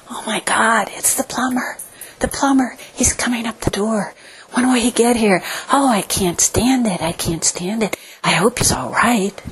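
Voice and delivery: whispered, soft anxious whimper; whimpering dog. Retold or imitated. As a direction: fret quietly like a nervous dog.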